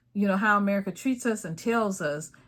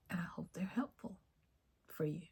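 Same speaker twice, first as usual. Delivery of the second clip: whispered aside to the viewers; 13 dB quieter than usual. Real, not acted. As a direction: drop to a breathy whisper as a sign-off. Soft sign-off to viewers.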